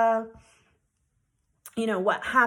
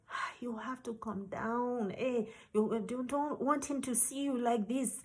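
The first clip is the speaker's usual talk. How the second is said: soft soothing; 9 dB quieter than usual. Retold or imitated. Boss soothing him.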